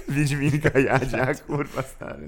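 laughing through the name; can barely get the name out for laughing; amused, laughing delivery. Real, not acted. Laughing at a silly witcher name.

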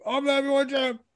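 very high, insistent champion character voice; Stipe. Acted. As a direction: defensively proclaim the title in a high-pitched put-on voice. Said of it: "Thank you, Stipe".

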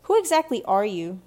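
loud, haughty question; pointed, snobbish demand; smirking challenge. A narrator's reading. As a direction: look down on a nobody. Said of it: "Frances added with a smirk".

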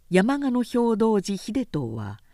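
ordinary delivery

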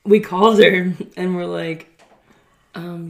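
ordinary talk

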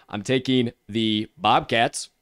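ordinary delivery

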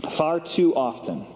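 ordinary talk

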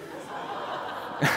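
a soft unvoiced laugh; short breathy chuckle before the punchline. Real, not acted. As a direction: let a quiet laugh slip out. Shows the pug photo.

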